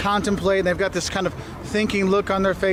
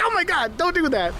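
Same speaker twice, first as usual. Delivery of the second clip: mock alarmed plea; 9 semitones higher than usual. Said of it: "It's like".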